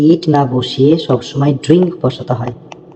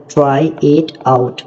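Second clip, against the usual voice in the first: very slow model reading; much slower than usual. Shown as a demonstration. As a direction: try it out, each word drawn out very slowly. Teacher models English phrase slowly. (খুব ধীরে ধীরে স্পষ্ট উচ্চারণ)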